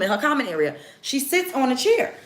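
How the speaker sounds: ordinary talk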